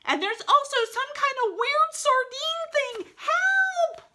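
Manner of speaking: very high, loud cartoon shrieks; frightened screaming. A narrator's reading. Frightened dogs see a snake, shrieking in panic. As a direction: scream for help in squealing panic. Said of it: "very frightened and they start screaming".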